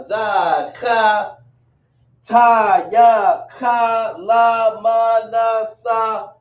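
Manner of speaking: each syllable said slowly and distinctly, "ah, ba, ga, da"; reciting alphabet sounds. Shown as a demonstration. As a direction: sound out every letter for the class. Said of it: "Give a second".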